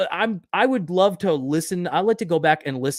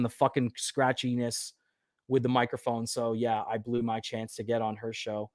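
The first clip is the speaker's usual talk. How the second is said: normal talking voice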